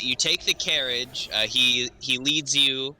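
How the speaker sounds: usual speaking voice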